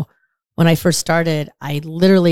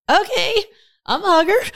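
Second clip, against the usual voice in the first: imitating his reply; 13 semitones higher than usual. Man's reply retold.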